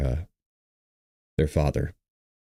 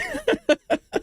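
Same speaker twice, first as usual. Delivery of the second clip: thin, very high burst of tearful breath; high broken sobbing laugh. Real, not acted. Grieving streamer overcome while live, high sobbing laugh. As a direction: let out a high, helpless sob-laugh. Breaking down on livestream about a death.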